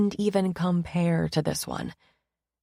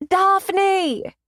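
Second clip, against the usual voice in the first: a loud, bad British accent on Daphne!; awful British accent; 13 semitones higher, 6 dB louder than usual. A narrator's reading. Layla calling out to her friend, a bad British accent. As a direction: yell Daphne! in a hammed-up fake British accent. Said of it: "in a very awful British accent".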